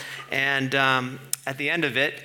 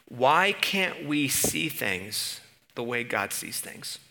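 ordinary talk, nothing marked

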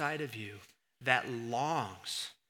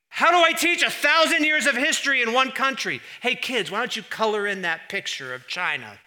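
mock teacher; 10 semitones higher, 10 dB louder than usual.

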